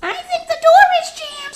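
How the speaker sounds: very high, loud piggy squeak; squeaky pig voice. Acted. Pig fibs to the wolf in a very high squeak. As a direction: squeak it like a sly little pig. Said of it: "said the pig".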